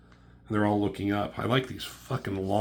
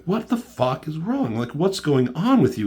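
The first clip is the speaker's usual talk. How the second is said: retold demand, higher and incredulous; exasperated confrontation; 8 semitones higher, 6 dB louder than usual. T'Challa shielding the guy confronts Iron Man, exasperated. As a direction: act out a raised-voice "what's wrong with you".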